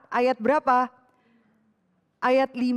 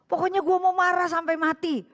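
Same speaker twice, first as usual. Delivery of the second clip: put-on higher, stubborn childish insistence; stubborn defiance; 7 semitones higher than usual. Angry person insists on staying angry, stubborn and high. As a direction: insist on it no matter what. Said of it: "Orang marah kan sering kali nggak masuk akal".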